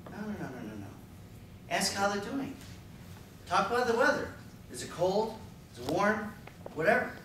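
normal talking voice

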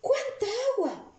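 very high-pitched astonishment; high burst of surprise; amazed exclamation. Acted. Reads exclamation sentence in amazement.

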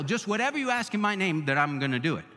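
rattled off fast and dismissive; mock quote, rushed. Preacher rattling off the false promise fast. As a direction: toss it off quickly in mockery. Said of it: "it doesn't have anything to do with".

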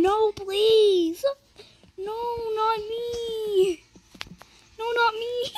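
high, drawn-out pleading squeal; play-scream a plea to be spared; mock scream. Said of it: "I'm just gonna scream".

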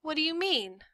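put-on high-pitched kid's question; high, small, suspicious girl; puzzled daughter. A narrator's reading. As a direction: ask mom in a child's voice.

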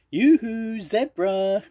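high, loud yoo-hoo call. Acted. Miss Pig hails her friend Zebra, calling out high. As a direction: sing out a high hail to a friend. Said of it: "called Miss Pig".

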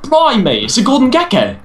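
high, laughing Aussie exclamation; hammed-up Australian, pitched high; mock Australian accent. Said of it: "Aussie accent is more like this".

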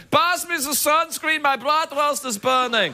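high, slow put-on German accent; mock German tourist. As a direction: ham up a German accent, drawn out. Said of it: "He was like".

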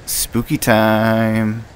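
slow, drawn-out spooky voice. Pretend. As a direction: drag it out like a ghost story. Entering creepy building in horror game.

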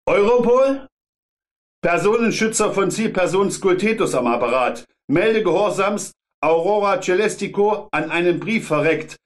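lightly voiced clipped military report; prussian officer. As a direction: report like a soldier to command. Bodyguard reports military style.

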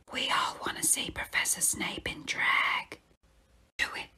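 voiceless urgent whisper. Acted. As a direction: urge it under your breath. Neville's whispered dare.

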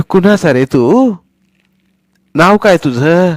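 a prying granny asking; curious grandmother. Acted. Grandmother asking Sonya whose boy he is, curious.

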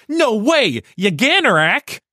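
high, excited anime shout of discovery; anime character. Retold or imitated. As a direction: squeal it like an anime hero learning something. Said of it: "It's like".

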